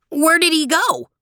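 a little dog's question, pitched high; high small-dog question. Acted. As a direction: squeak it like a small eager dog. Small dog Theo asks.

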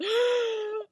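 sharp, high gasp of horror; horrified gasp. Retold or imitated. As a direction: gasp as if you see something terrible. Snow sees the hook, horror.

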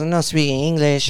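put-on slow broken English, lightly accented; mock flat refusal, slowly; imitating the driver. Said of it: "she goes".

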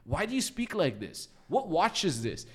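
put-on high, eager rapid-fire drunken questions; drunk curious barrage. Drunk speaker quizzing the British lads, high eager voice. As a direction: interrogate them like a nosy tipsy friend. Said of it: "I'm like".

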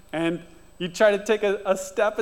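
normal talking voice

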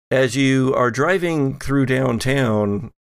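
usual speaking voice

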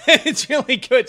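laughing through the words; real laugh while talking. Real, not acted. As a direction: let the laugh carry it. Laughing at himself.

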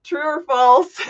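giggling through the words; laughing while trying to talk; stifled giggles. Real, not acted. Host laughing at co-host.

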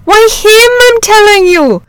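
loud, very high exasperated cry; frantic, high-pitched insistence; agitated insistence. A narrator's reading. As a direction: burst out as a rattled shepherd. Frightened shepherd insists on what he saw.

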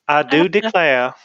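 slow drawled Southern Belle line; Southern Belle accent. Acted. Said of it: "sound like a Southern Belle".

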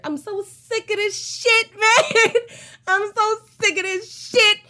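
exasperated, half laughing it off; fed up. Acted. Fed up with the relationship.

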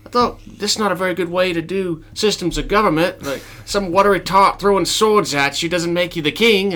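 high, loud mock indignation; argumentative peasant. Peasant disputing systems of government, high indignant voice. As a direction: argue back as a put-on peasant. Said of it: "And they're like".

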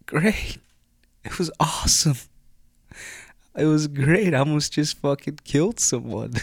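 high, sing-song fake cheer; mock chipper sarcasm; sarcastic. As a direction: sell it sweetly, then undercut. Sarcastic reply to partner.

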